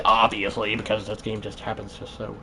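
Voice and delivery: ordinary talk, nothing marked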